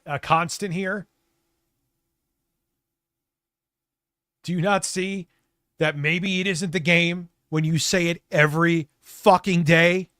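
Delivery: fast, loud, building to a furious yell; exasperated rant. Pretend. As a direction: lose patience and hammer it home.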